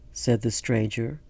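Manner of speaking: plain reading voice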